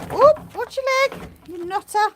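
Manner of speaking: very high squeaky warning; fond startled cry to the animal; pet talk. Real, not acted. Talking to the animal.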